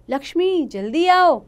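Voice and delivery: loud, high alarmed call; urgent call. Acted. Mother calls daughter when husband collapses, urgent. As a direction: call for help in a panic.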